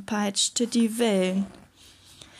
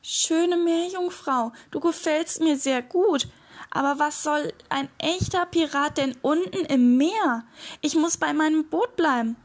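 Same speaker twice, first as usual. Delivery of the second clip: higher, lightly voiced little pirate; sighing little pirate; 5 semitones higher than usual. A narrator's reading. Little pirate refusing the mermaid's offer, mildly sighing. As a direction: turn her down with a touch of a sigh. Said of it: "Der kleine Pirat seufzte".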